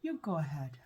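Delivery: soft unvoiced whisper. Real, not acted. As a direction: breathe it out, no voice. Mouth full of icy gum.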